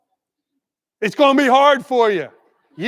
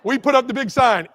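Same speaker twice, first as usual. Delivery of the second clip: normal talking voice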